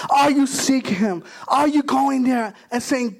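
usual speaking voice

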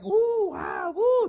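high, loud mock wooing; hammed-up admiring cheer; impressed crowd. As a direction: whoop like an awed onlooker. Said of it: "of everybody like".

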